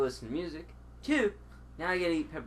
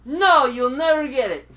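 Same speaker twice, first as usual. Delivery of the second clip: loud smug; 8 semitones higher, 10 dB louder than usual. Real, not acted.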